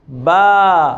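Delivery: बा with the a held for two beats; long syllable demo. Shown as a demonstration. Teacher showing the doubled vowel in बा. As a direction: stretch बा to double length. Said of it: "दो हरकत के बराबर होगी".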